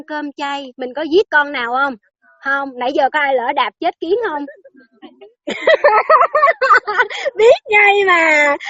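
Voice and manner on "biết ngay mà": slow words through a high laugh. Real, not acted. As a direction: laugh out "Biết ngay mà". Laughing at ant-stepping joke.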